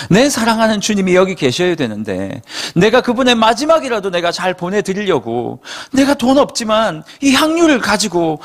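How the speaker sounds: Mary pleading in tears for the missing body; tearful plea. Acted. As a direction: beg through rising tears. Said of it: "하고 엉엉 울고 있는 거죠".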